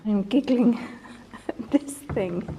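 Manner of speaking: talking through a real giggle; giggling. Real, not acted. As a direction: let a giggle break into the words. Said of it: "I shouldn't giggle".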